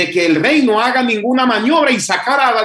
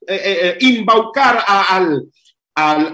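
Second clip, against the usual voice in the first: ordinary delivery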